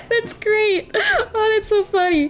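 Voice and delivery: high, tearful laughter; near-crying giggle fit; laughing. Real, not acted. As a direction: wheeze the words out between laughs. Laughing hard at game reaction.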